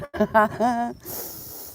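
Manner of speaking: laughter in two bursts; giggling laugh. Real, not acted. Vlogger breaks off mid-sentence to laugh, giggling laugh.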